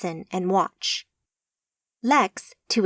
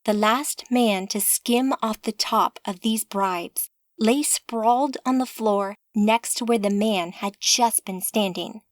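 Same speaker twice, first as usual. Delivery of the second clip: ordinary delivery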